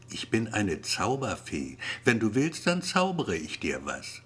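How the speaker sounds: plain reading voice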